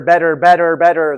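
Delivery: better with a strong rolled American r, tapped t like bedder; American better, t flapped and r at the end; American pronunciation. Shown as a demonstration. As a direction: say better the American way, r clearly sounded. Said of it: "that is the American pronunciation".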